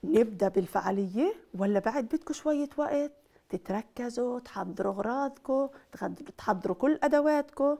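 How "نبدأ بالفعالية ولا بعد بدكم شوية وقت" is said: ordinary talk, nothing marked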